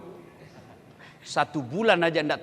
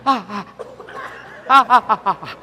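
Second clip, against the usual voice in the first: mock pained cries; 3 semitones higher, 6 dB louder than usual. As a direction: yelp in comic pain. Mother bitten while nursing.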